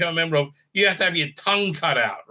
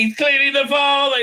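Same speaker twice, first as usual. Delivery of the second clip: raised, louder burst of disbelief; exasperated outburst; 9 semitones higher, 6 dB louder than usual. Retold or imitated. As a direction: blurt it out in mock exasperation. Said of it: "He's, he's like".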